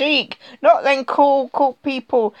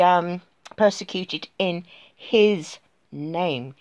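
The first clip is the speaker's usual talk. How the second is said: ordinary talk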